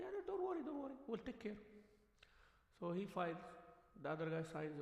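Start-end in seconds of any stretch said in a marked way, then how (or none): 0.0-1.6 higher, smooth mock lawyer voice; reassuring lawyer. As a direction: play the slick lawyer calming his client. Lawyer reassures the client in story.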